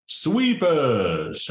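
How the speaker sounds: high, drawn-out summons at normal volume; contained in-character holler; mock yell. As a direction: call out for the guards without really shouting. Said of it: "and yells".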